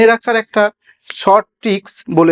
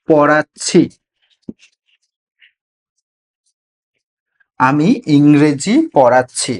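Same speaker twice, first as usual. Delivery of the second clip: teacher spelling out an example sentence; slow word by word example; slower than usual. Shown as a demonstration. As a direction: say আমি, ইংরেজি, পড়াচ্ছি one word at a time, then the whole sentence slowly. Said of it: "এইটাকেই যদি আমি ভেঙে উচ্চারণ করি".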